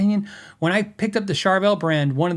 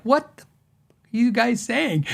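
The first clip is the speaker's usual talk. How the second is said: baffled; 6 semitones higher than usual. Retold or imitated. Baffled retold reaction.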